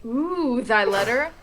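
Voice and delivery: high, loud and thrilled; giddy excitement. Acted. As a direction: squeal over the letter. Lady excited over letter.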